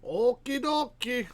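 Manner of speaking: high, drawn-out okie dokie; sing-song okie dokie. Acted. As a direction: sing it out slowly. Repeats in sing-song, higher.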